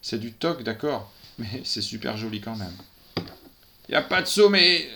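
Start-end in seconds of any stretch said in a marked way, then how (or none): 3.9-4.9 blurted cry. A narrator's reading. Policewoman blurts.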